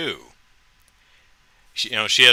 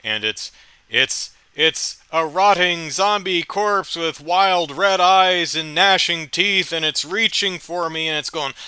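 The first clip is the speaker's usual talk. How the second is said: normal talking voice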